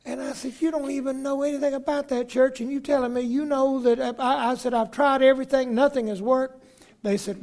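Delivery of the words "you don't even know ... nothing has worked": pitched high, protesting hard; indignant protest. Retold or imitated. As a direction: push back, stung and exasperated. Retold argument with superintendent.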